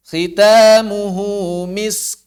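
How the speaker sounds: Khitamuhu misk stretched slowly; slow recitation. Shown as a demonstration. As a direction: draw out misk ending on breathy k. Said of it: "model recitation". (tilawah pelan)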